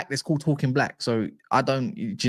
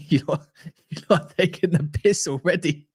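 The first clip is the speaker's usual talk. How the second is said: laughing; 4 semitones higher, slower than usual. Real, not acted. Chat teasing him about Chevalier.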